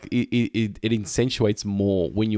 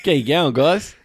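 lightly exaggerated Aussie greeting, raised and louder; Aussie accent; 6 semitones higher, 5 dB louder, slower than usual. Co-host echoing the meeting greeting, playful broad Aussie. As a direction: echo the broad Aussie hello.

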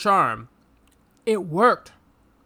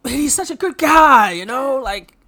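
higher, louder, slow mock rave; sarcastic gushing; 6 semitones higher, 6 dB louder, slower than usual. Real, not acted. Ribbing co-host about the perfect hero, loud sarcastic gushing. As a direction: rave about him ironically.

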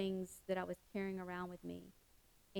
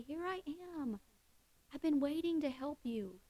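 much higher, gentle welcoming voice; tender God; 6 semitones higher than usual. Retold or imitated. God embracing the listener, tender and higher. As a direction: open your arms to a tired child.

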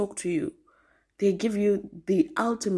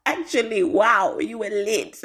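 retold cousin's loud, higher exclamation; amused astonishment; 4 semitones higher, 5 dB louder than usual. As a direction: imitate a cousin loudly marvelling at you. Cousin laughingly teasing after waking up.

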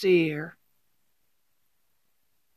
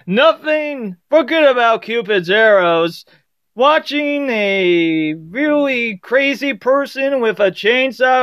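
cheesy game show host; 3 semitones higher than usual. Pretend. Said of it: "I'm gonna give you the cheesiest nineteen seventies game show host voice".

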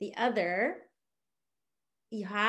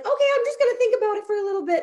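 much higher, fast, put-on polite retreat; polite brush-off; 12 semitones higher, 6 dB louder, much faster than usual. Relative politely brushing off an overeager seller, high and quick. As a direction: back away quickly in a high dainty voice. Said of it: "She was like".

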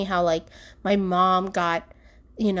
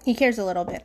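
ordinary talk, nothing marked

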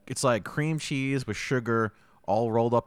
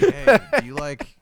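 mock sales pitch; 4 semitones higher, 6 dB louder, slower than usual. Mock drug offer.

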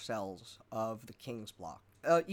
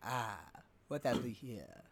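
lower, softer, slow sinister greeting; a lightly voiced brain in a jar; 4 semitones lower, 7 dB quieter, slower than usual. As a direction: savor the words like a sly villain. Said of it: "the brain speaks".

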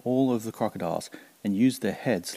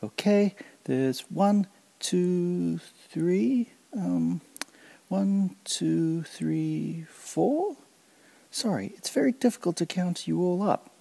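much higher, slow fumbling count; Kancil voice; 9 semitones higher, slower than usual. Acted. Kancil pretending he can't count the crocodiles, high and fumbling. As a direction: pretend to lose count, bright and playful.

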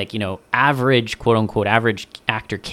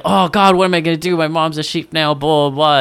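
higher, louder, hammed-up panicked kid; mock panic; 6 semitones higher, 6 dB louder than usual. Kid in the ad panics after his mom turns into a sheep, in a mock panic. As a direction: ham up a kid freaking out. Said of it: "it's very like".

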